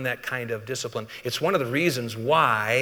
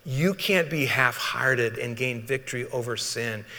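ordinary delivery

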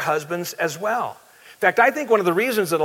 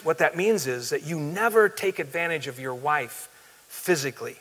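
ordinary delivery

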